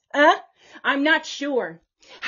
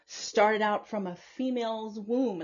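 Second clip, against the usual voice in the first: usual speaking voice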